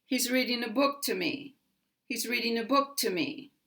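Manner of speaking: "me" unstressed, voice going down; brisk line with falling "me"; unstressed falling ending. Shown as a demonstration. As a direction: stress "book", let "me" drop away. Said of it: "my voice goes down at the end".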